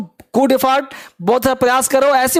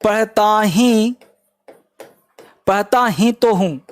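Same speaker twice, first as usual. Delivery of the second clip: slow dictation; 2 semitones lower, slower than usual. Shown as a demonstration. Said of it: "emphatic है".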